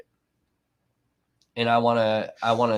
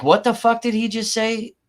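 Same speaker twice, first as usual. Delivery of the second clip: shocked; 10 semitones higher, 5 dB louder than usual. Retold or imitated. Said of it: "I'm like".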